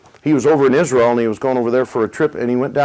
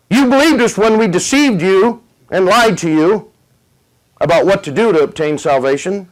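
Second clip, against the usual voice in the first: high, louder taunting laughter and jeers; mocking demons; 6 semitones higher, 3 dB louder than usual. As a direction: gloat over the trapped soul, then settle. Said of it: "They just laughed and said".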